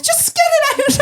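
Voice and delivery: high-pitched comic panic; panicked plea. Retold or imitated. Patient begging doctor to take the coil out, panicked plea.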